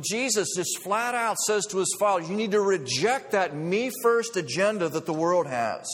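impassioned pulpit climax; full-force preaching. Acted. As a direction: preach it with conviction.

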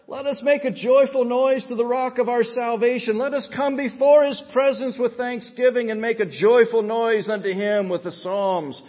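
high, loud, ringing recitation; exultant psalm declamation. Acted. Preacher reading the psalmist's invitation to praise, exultant proclamation.

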